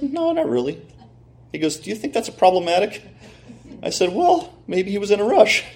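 high, small sheepish voice; sheepish admission. Retold or imitated. Autograph owner answers the card dealer, sheepish.

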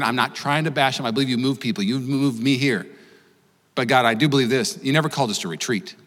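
soft, low, earnest prayer. Acted. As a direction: pray quietly and steadily over the church. Leads the congregation in prayer.

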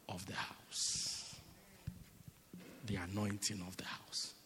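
hushed, breathy repeat of "the anointing of the house"; voiceless, intimate aside; whisper. Acted. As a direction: drop to a whisper for effect. Whispered repeat of key phrase.

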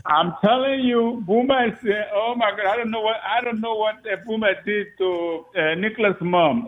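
can't believe what Boomer did; amused disbelief. Real, not acted.

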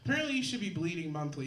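ordinary talk, nothing marked

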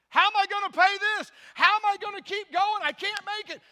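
loud, high outburst; acted desperation. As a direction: cry out over a bill you can't pay. Acted despair over a bill.

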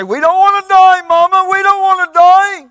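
very high, loud wail; acted shrieking, tearful plea; crying teenagers. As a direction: wail to your mother in terror. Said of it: "begins to cry".

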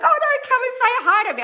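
high, loud mock-pleading call; urging the fan to come over, squealing; hammed-up high, loud invitation; eager plea. Imagined eager reply to shy fan.

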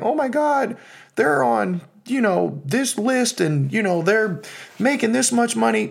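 high, sing-song mock of a bragger; mocking, high, sing-song impression. Mocking status-chasing talk.

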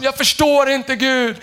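high-pitched mock complaint; imitated cry to God. Pastor imitating a confused believer calling on God, high mock cry. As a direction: protest upward, as if baffled. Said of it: "Tänk ofta vi ropar så här".

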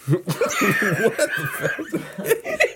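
laughing. Real, not acted. Laughing disbelief.

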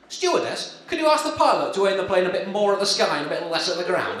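doomed passenger asking politely. Acted. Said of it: "this is my [name] impression".

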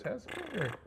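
high, sneering taunt; mocking taunt. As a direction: toss it across the street mockingly. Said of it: "Hearst sees him ... and goes".

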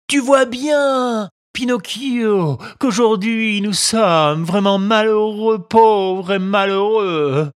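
put-on a sly old fox begging pitifully; whining fox. A narrator's reading. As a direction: fox drawing out every word to wring out pity.

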